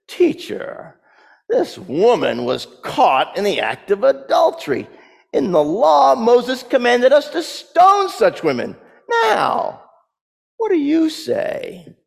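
sleazy voice. Acted. Pharisees baiting Jesus over a woman caught in adultery, sleazy. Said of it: "And in a sleazy voice".

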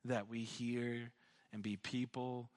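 low, soft prayer voice; subdued, reverent prayer. Real, not acted. Leads the closing prayer.

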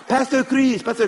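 high-pitched attempt at a Chinese accent; imitated Chinese accent. Missionary runs out after the pastor, imitated Chinese accent. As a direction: call out in a put-on Chinese accent. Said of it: "that's my best Chinese accent I got".

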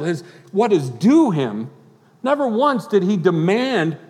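loud, high, driving sermon delivery; preaching at full force. Acted. Pastor preaching Christ's humility to the congregation, loud and high.

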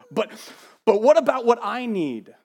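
high, loud whining protest; mock whiny protest. Preacher voicing a selfish churchgoer, loud mock whine. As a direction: cry out like a spoiled child.

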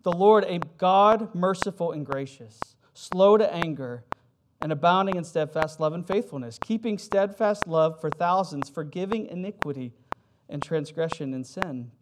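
proclaimed scripture. Acted. Preacher reads Exodus to the congregation, proclaiming. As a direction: proclaim each word of the verse.

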